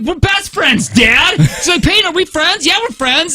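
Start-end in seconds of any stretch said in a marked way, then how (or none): 0.0-1.4 put-on child voice. Kids voiced in story. 1.8-3.3 put-on child voice. Girls voiced in story.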